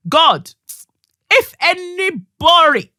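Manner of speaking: loud high cry to God; loud high fervent cry; passionate shout. Real, not acted. Passionate plea about the role.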